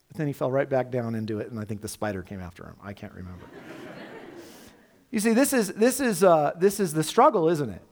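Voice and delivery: usual speaking voice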